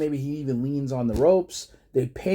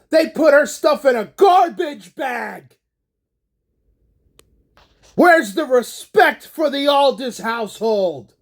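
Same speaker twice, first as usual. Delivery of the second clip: higher, louder shouting rant; furious wrestling promo; 5 semitones higher, 3 dB louder than usual. Retold or imitated. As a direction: scream the grievances at the crowd. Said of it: "And he goes".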